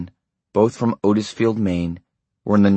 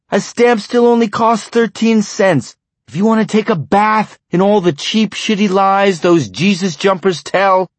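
furious tirade; 12 semitones higher, 8 dB louder than usual. A narrator's reading. Husband laying down the law to his wife, furious shouting. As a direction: hammer each line at her in rage.

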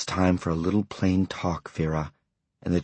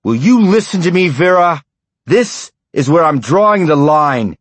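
furious tirade; 12 semitones higher, 8 dB louder than usual. A narrator's reading. Husband laying down the law to his wife, furious shouting. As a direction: hammer each line at her in rage.

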